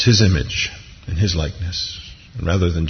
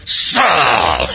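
hammed-up angry booming call; angry voice from heaven; 2 semitones higher than usual. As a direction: bellow the name like a wrathful judge. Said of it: "I don't think this is angry".

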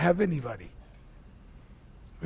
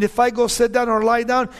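ordinary talk, nothing marked

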